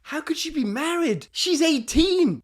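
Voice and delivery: high-pitched, incredulous retold protest; shocked disbelief. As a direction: blurt it in astonishment, stretching the last words. Reacts to shocking wedding news.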